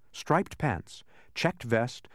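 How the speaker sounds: ordinary delivery, nothing marked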